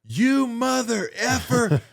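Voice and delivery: high, slow, outraged yell; drawn-out mock-furious cussing; cussing someone out. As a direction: imitate a furious man cursing you out. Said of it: "he starts dog cussing me".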